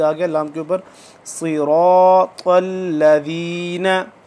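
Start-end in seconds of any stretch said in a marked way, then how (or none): 1.2-4.1 सिरातल लज़ीना with a held doubled ल, slow; drawn recitation. Shown as a demonstration. Teacher recites सिरातल लज़ीना for students, drawn out. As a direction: hold the ल in लज़ीना. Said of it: "इसको खींचेंगे".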